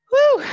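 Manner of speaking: loud high whoop of triumph; cheering woo. Real, not acted. Instructor cheering after a set.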